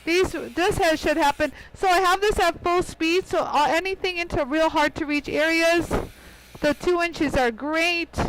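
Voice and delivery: usual speaking voice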